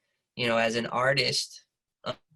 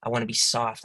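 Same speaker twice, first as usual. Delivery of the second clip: usual speaking voice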